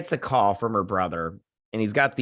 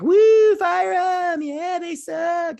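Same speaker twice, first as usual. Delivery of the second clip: very high, whooping crowd imitation; mock crowd cheer; 8 dB louder, much slower than usual. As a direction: whoop like an over-excited crowd, hammed-up.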